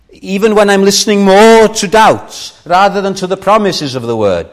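fervent preaching. Real, not acted. Preacher urging his congregation, fervent and loud. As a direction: build it up like a pulpit appeal.

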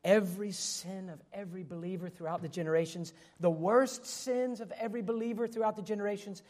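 usual speaking voice

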